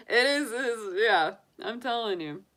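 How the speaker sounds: ordinary talk, nothing marked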